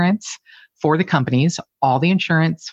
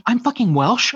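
higher, mock-amazed exclaim; surprised delight; 8 semitones higher than usual. Realizing her [name] name is Welsh, higher mock glee. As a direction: blurt the discovery with glee. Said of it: "I'm like".

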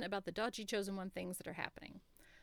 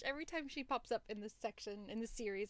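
normal talking voice

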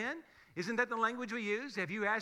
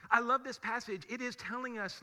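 ordinary talk

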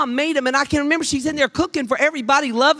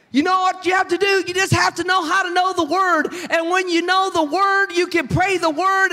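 high, insistent preacher's delivery; fervent preaching; 6 semitones higher than usual. Real, not acted. Pastor telling the church to learn the word, fervent preaching. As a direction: drive each phrase home, pitched high. Preaches her point to congregation.

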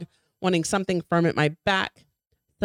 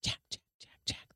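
unvoiced eerie whisper of the name; ghostly whisper; 15 dB quieter than usual. A narrator's reading. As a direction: breathe the name like a spirit in the fog. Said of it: "hissing from a completely different".

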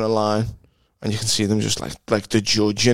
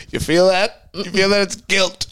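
mimicking Jordan's punchline; 8 semitones higher, slower than usual. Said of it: "And he goes".

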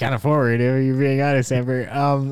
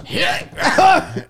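high, strained jump yell; high yelp on the leap; effort yell. Pretend. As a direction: let out a big cry of exertion. Launches into the jump.

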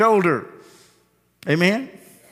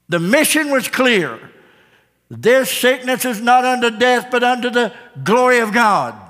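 raised, ringing pulpit voice; preaching at full force; 5 semitones higher than usual. Acted. Pastor proclaiming scripture to his congregation, full-force preaching.

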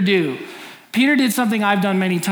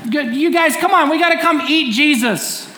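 high, loud mock sermon call; hammed-up eager preacher inviting the crowd; mock street preaching; 8 semitones higher, 4 dB louder than usual. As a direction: hype up the square like a goofy street preacher. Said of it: "just preaching that".

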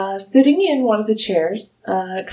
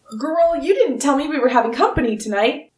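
put-on a lightly raised, quick old gnome voice; an old gnome caught off guard, a touch higher; startled old gnome; 6 semitones higher, faster than usual.

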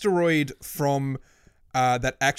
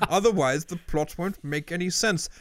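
attempted British accent for Kasparov; 3 semitones higher, slower than usual. Podcaster voicing Kasparov offering his cheek swab, mock British accent. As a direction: put on a mock British accent, drawn-out pleading at times. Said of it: "I did a British accent".

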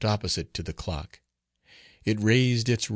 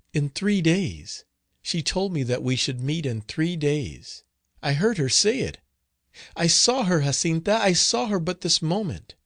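high, agitated outpouring; overwrought, high-pitched girl; frightened insistence; 7 semitones higher than usual. A narrator's reading. Terrified girl tells of the ghost.

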